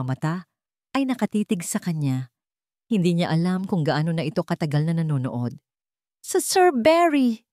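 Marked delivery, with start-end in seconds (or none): 6.2-7.5 high, loud, slow frightened stammer; stammering in fright. A narrator's reading. Caught by Barry in the warehouse, stammering high in fright. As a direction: stumble over the words, caught off guard. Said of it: "Nauutal niyang sabi".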